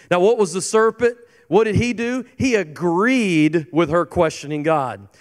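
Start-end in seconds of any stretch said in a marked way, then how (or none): none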